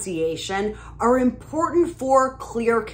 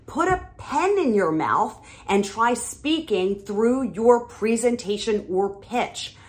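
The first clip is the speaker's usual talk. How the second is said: ordinary talk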